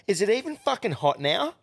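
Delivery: imitating an outraged customer; mock angry woman. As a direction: mimic her grilling the staff. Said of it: "she goes".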